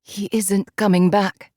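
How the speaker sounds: plain reading voice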